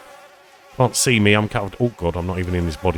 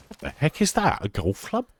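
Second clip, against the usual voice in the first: surprised; 8 semitones higher than usual. Real, not acted. Spots strange item in game.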